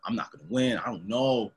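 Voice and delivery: imitating a scared loser, softly. Mocking people who go in scared, quiet and low. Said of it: "there saying".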